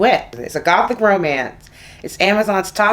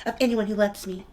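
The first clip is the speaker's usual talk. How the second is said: put-on girl voice; 4 semitones higher, 4 dB quieter, faster than usual. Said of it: "I'll do the girl voice again".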